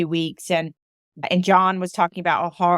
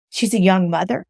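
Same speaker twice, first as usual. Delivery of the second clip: ordinary talk, nothing marked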